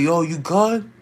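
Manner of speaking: imitated shout, questions pitched high; shouted concern, retold. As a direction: call across to check on a stranger. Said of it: "shouting, I'm like".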